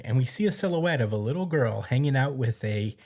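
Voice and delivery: usual speaking voice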